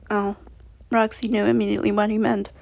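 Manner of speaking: plain reading voice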